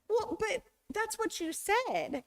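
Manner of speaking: high, insistent confusion; puzzled protest. Retold or imitated. Student insists on literal meaning.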